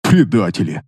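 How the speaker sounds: low, scoffing Предатели; scornful snort. Acted. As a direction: sneer the word Предатели low and falling. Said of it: "фыркнул Кастер".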